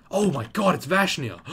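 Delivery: squealing, high-pitched starstruck fan; excited fangirl. Pretend. Fangirling over game character cosplay.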